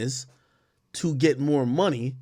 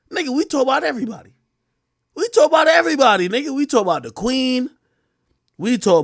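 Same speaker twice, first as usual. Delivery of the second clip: worked-up; 8 semitones higher, 5 dB louder, faster than usual. Real, not acted. Heated protest to chat.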